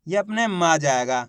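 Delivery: ordinary talk, nothing marked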